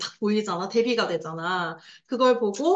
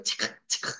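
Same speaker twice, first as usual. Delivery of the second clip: imitated camera click; 6 dB quieter than usual.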